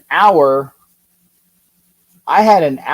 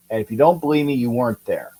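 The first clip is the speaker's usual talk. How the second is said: normal talking voice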